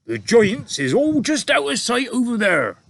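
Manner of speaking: booming high giant voice, loud; BFG giant voice. A narrator's reading. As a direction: giant warning the girl to hold tight, loud giant voice. BFG voiced in read story.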